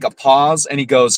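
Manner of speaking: usual speaking voice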